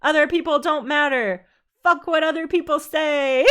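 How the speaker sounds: put-on a touch of Valley Girl. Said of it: "I sound like a Valley Girl".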